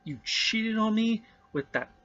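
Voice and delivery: jealous accusation. Acted. Jealous director accuses cinematographer.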